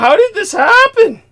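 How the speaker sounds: very high, loud, astonished question; shocked outburst. Real, not acted. Host asking a burned guest what happened, appalled. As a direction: cry out in real alarm, far up in pitch.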